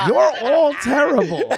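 laughing protest, loud and high. Real, not acted. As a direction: cry it out through laughter.

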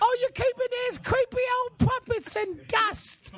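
silly squeaky mock British; hammed-up homeowner in a high squeak. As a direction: stretch it out in a put-on squeak. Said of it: "high squeaky voice convention".

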